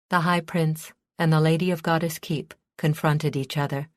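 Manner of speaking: usual speaking voice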